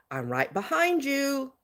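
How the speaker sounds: creepy sing-song. Retold or imitated. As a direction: imitate a creep softly singing out. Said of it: "in a creepy sing-songy voice".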